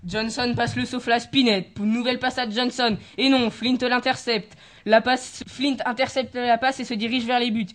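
loud live match commentary; play-by-play. Acted. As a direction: call every pass as it happens. (commentaire en direct, fort)

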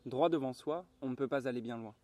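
ordinary delivery, nothing marked

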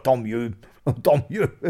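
hearty chuckle between the words; jovial, self-satisfied laughing; satisfied laughter. Acted. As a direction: laugh contentedly as you approve. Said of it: "Il se mit à rire d'un bon rire satisfait".

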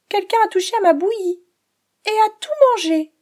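little cub wailing that it's all gone; squeaky baby bear upset; tiny bear's little voice. A narrator's reading. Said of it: "dit-il de sa toute petite voix".

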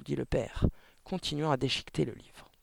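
ordinary delivery

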